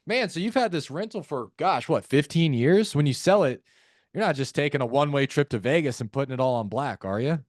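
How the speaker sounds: lifted, teasing mock wonder; playful tone. Shown as a demonstration. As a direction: kid them about blowing the money. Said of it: "use a playful tone".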